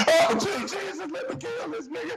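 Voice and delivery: high mock girlfriend voice, exasperated; teasing friend voices the girlfriend, exasperated; mocking her voice. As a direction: squeak out her fed-up prayer. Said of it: "what she was saying".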